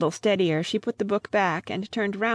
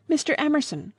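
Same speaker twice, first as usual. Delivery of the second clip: cried out; 8 semitones higher than usual. A narrator's reading. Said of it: "cried the girl".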